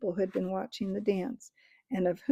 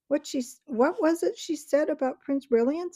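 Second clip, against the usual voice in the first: lightly voiced, higher curious creature; 7 semitones higher, 4 dB louder than usual. A narrator's reading. A creature asking the crowd about the prince, curious. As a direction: ask it with eager curiosity.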